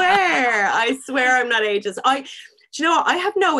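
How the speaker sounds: high, loud, half-laughing denial; laughing protest. Real, not acted. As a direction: swear innocence over the laughter. Keeps protesting while being teased.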